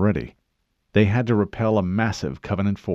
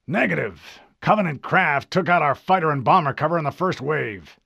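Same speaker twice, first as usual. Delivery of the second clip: higher, curt officer voice; admiral's curt reply; 8 semitones higher than usual. Acted. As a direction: clip it like a tired admiral. Admiral replies.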